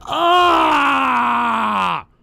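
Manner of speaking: long, loud high "ah"; mock scream. Host reacting to a painful story, mock scream. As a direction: hold a drawn-out cry of mock agony.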